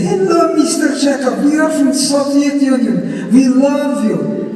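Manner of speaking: high, slow, gushing fan greeting; imitated young women. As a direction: imitate starstruck visitors from the Soviet Union. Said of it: "two young women come to me and say".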